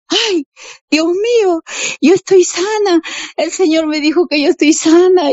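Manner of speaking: mucho más agudo, exclamando eufórica; exclamación de alegría. Retold or imitated. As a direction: revive la alegría de sentirse sana. Recuerda su curación, grita de alegría. (grito alegre y agudo de mujer recién curada)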